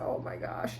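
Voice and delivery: breathless whispered gasp of shock. Retold or imitated. Reacting to the grieving boy, hushed whisper of shock. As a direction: whisper it like the news just hit you. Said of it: "And I was like".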